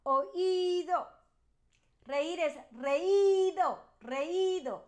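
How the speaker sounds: slow, careful model readings of each verb pair; slow pronunciation drill. Shown as a demonstration. Teacher modeling Spanish participles for students, slow and clear. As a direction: say each word clearly so students can repeat.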